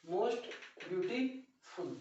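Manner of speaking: drawn-out teacher repetition of most beautiful; slow model phrase. Shown as a demonstration. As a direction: say most beautiful slowly for the class to copy. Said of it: "repeats it slowly".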